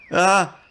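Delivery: sudden high yelp of fright; startled yelp. Acted. Slime startled as the adventurer wakes, high yelp. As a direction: jump and yelp as they wake.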